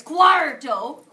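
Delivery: loud Cuarto with a pirate ar, rising. Shown as a demonstration. Teacher coaxing the little pirates to repeat. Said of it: "come on, little pirates".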